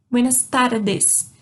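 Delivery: slow "tardes" with a clean "des" ending; careful corrected "tardes", no "dj"; hard D. Shown as a demonstration. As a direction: say "tardes" slowly, tapped r, plain d. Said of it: "é um som de D".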